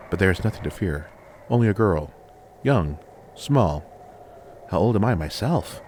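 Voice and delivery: ordinary delivery, nothing marked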